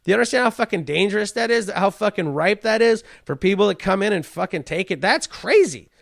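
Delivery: normal talking voice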